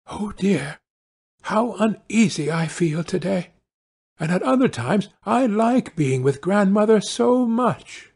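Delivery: put-on high child voice, fretting; high, troubled storybook girl; uneasy little girl. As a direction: worry to yourself as Little Red. Girl talks to herself.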